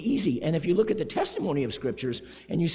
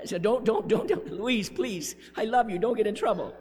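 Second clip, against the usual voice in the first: hurried, higher mock begging; mock pleading; 7 semitones higher, 5 dB louder than usual. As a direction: plead playfully, louder, tumbling over the words. Jokingly begs Louise not to react.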